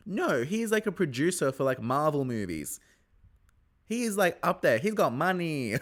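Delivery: high, bright gossip about his money; excited gush. Real, not acted. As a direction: gush like spilling juicy news. Gushing gossip about producer.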